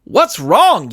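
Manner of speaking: the coach yelling back at the villain. Acted. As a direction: throw the question back in a yell. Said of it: "yelled the coach".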